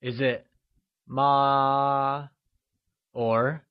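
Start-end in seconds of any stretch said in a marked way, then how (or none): 1.1-2.3 "ma" held long on one level pitch; high level tone. Shown as a demonstration. Teacher offers a quiz choice, "ma" in high level tone. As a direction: draw out "ma" without rise or fall. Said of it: "constant high-level tone".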